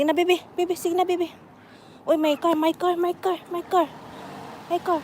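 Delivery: put-on high baby talk to a pet; squeaky praising a dog.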